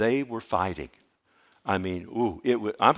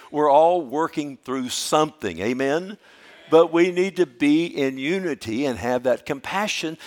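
full-force preaching; 4 semitones higher, 6 dB louder than usual. Acted. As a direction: preach it out loud to the congregation.

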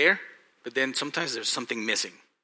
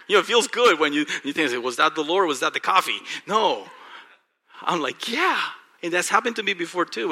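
loud, high, fast excited preaching; bubbling over with excitement; 5 semitones higher, 7 dB louder than usual. Real, not acted. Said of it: "I was so excited".

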